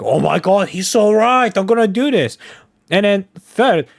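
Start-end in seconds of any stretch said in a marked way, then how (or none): 0.0-2.4 gullible fan. Retold or imitated. Streamer mocking a gullible viewer, in a high gushing mock voice. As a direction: ham up a starstruck moron swallowing bad advice. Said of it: "that one moron that'll be like".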